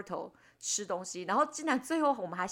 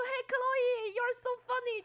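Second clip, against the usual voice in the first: quoting friends; 13 semitones higher, slower than usual. Retold or imitated. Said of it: "你们就说".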